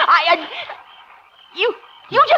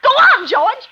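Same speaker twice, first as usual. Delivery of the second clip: desperate pleading; 3 semitones higher, much slower than usual. Acted. Terrified wife begs her husband George on the phone, desperate. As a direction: beg him not to hang up.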